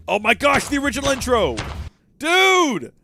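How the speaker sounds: loud, high, thrilled outburst; excited. Real, not acted. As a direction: burst out with delight. Spotting the old intro.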